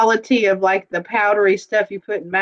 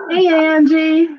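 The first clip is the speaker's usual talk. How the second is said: playful mock greeting; 8 semitones higher, 4 dB louder than usual. Echoing partner's greeting, laughing.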